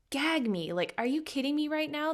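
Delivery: high, scoffing disbelief; mock disgust. Stylist scoffing at strict coaches, mock disgusted. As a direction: roll your eyes and scoff it up high. Her own disgusted reaction.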